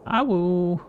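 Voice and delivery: high, thin pup howl; howling like a wolf pup. Pretend. Mimicking wolf howl in game.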